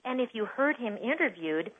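ordinary talk, nothing marked

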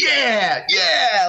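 high, drawn-out triumphant yeah; acted-out gleeful approval; imitated cheering. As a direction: cheer like a friend egging someone on. Voicing friend cheering on the reading.

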